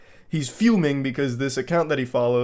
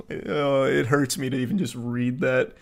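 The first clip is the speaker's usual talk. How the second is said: normal talking voice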